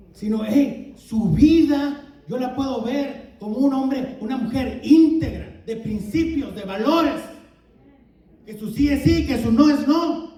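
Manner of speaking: predicando fuerte, con convicción; proclámalo con fuerza ante la congregación; predicación enérgica. Acted. (sermón enérgico y fervoroso)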